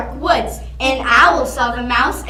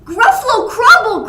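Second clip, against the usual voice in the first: high, loud, odd-voiced laughing echo; Gruffalo voice; 10 semitones higher, 8 dB louder than usual. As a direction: echo it in a monster voice. Gruffalo repeats in shock.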